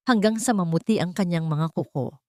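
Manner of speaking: ordinary delivery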